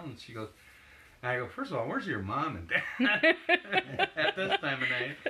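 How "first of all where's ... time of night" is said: high laughing retelling of the question; laughing while quoting. Retold or imitated. Photographer retelling what he asked the girl, laughing. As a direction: laugh through the words, half mocking.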